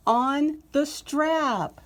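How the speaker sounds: thrilled drawn-out reveal; excited emphasis. Real, not acted. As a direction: stretch it out in delight.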